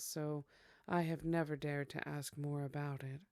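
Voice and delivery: usual speaking voice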